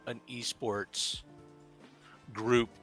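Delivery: normal talking voice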